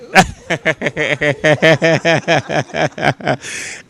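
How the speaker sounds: real laugh, rolling on and on; long chuckling laugh. Real, not acted. Host teasing a familiar guest, long laugh. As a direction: laugh heartily at your own joke.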